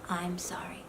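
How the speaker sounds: barely whispered, very low; tiny acted whispered apology; husband's reluctant apology. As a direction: whisper a grudging sorry under the breath. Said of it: "Even maybe with that kind of face".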